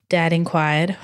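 ordinary delivery, nothing marked

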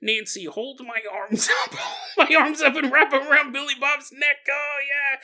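a dying grandma shouting high for help with her arms; mock frail grandma calling out. Grandma on her deathbed calls to Nancy to lift her arms, high laughing shout. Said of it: "you'll be like".

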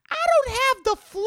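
falsetto dismissive denial; high mock denial. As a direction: squeak it out dismissively. High put-on voice.

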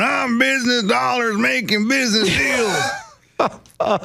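high, slow, shouted business talk; mock businessman. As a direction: boom out a mock businessman brag. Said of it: "you're over there going".

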